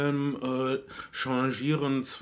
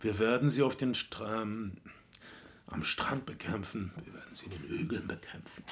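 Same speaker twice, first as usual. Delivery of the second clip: quiet, low imitation of a tired speech; imitating a sad, exhausted orator; resigned; 3 semitones lower, 7 dB quieter than usual. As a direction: let it sink away, softer and lower, weary. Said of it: "er sagt es resigniert fast".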